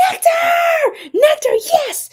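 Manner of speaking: high, loud delighted shout of a name; excited shout. Real, not acted. Streamer greets a regular viewer joining, high excited shout. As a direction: squeal the name with joy.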